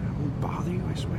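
unvoiced whisper. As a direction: breathe the words softly. Whispered repeat of the booming line.

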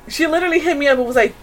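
ordinary talk, nothing marked

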